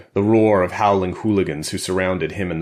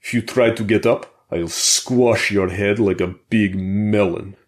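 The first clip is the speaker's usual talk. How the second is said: put-on thick Russian accent; 3 semitones higher, 4 dB louder than usual. A narrator's reading. Boris threatening Colt in the prison yard, Russian accent. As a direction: menace him in a heavy Russian accent. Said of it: "he said with a thick Russian accent".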